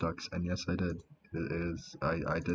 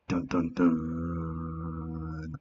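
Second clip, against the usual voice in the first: dramatic dun dun dun; 2 semitones lower, much slower than usual. Acted. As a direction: draw out each dun like a suspense sting. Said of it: "I gotta do that voice again".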